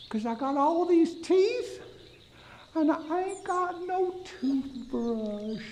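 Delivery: a sorry little gator; a lightly voiced sad gator. The gator answers him.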